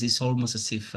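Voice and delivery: ordinary talk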